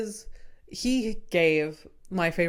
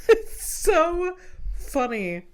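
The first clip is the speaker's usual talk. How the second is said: laughing; 9 semitones higher, 4 dB louder, slower than usual. Real, not acted. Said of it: "still laughing".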